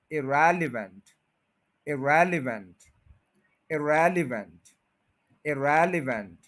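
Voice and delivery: Irrelevant with रेल stressed; weight on रेल in irrelevant; stress on REL. Shown as a demonstration. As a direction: correct irrelevant, रेल strong. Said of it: "रेल पे stress है".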